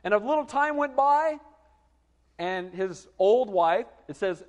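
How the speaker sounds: ordinary talk, nothing marked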